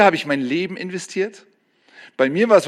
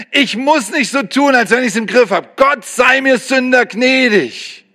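pleading sinner's prayer; 8 semitones higher than usual. Acted. Preacher acting a sinner begging God for mercy, pleading. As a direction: beg God for mercy, voice raised high. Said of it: "dass du dir an die Brust schlägst und sagst".

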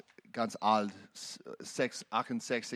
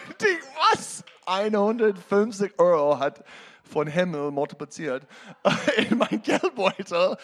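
laughing amazement; 9 semitones higher, 7 dB louder than usual. Real, not acted. Amazed money multiplied, laughing.

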